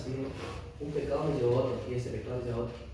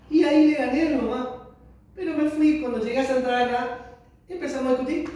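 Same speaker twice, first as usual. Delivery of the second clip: loud, much higher, worked-up telling; heated loud retelling; 8 semitones higher, 8 dB louder than usual. Real, not acted. Telling the church about fighting with mom, loud and worked up. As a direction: burst out reliving the fight.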